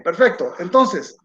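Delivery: ordinary talk, nothing marked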